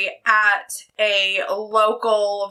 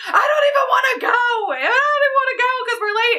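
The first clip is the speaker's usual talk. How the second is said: hammed-up panicked cry about being late; mock tantrum wail; 13 semitones higher than usual. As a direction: wail it like a child throwing a tantrum.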